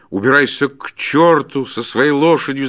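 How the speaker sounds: loud, high, furious dismissal; angry shout after someone. A narrator's reading. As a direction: yell it after the man you threw out. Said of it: "закричал ему вслед".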